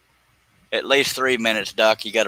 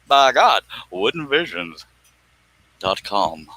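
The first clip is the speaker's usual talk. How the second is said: punchy announcer plug for the website; hammed-up commercial announcer; slower than usual. Shown as a demonstration. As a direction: sell the brand like a radio ad. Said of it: "That was my commercial voice".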